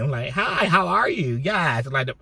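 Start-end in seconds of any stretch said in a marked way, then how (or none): 0.3-1.4 higher, slow, sugary hello; cheerful greeter. Retold or imitated. Aide showing how she greets everyone, high and slow with sing-song cheer. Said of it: "I'm, like, gummy".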